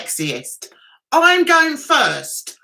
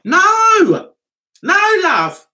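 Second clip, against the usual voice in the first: louder and higher, a playful no; mock loud denial; 6 semitones higher, 5 dB louder, much slower than usual. Telling strangers she's not Brazilian, a mock loud denial. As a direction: bat it away loudly, half joking.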